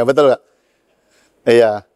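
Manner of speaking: ordinary talk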